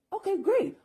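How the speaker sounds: high-pitched, naively pleased acceptance; mock eager agreement. Acted reply to Enki's offer.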